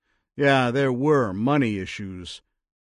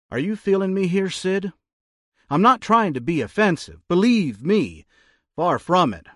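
raised, louder, pressing voice; insistent interrogator; 8 semitones higher, 5 dB louder than usual. A narrator's reading. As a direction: push hard while claiming no offense. Tom presses Sid.

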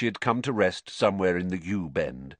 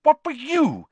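shocked prefect; 5 dB louder than usual. Acted. Percy shocked.

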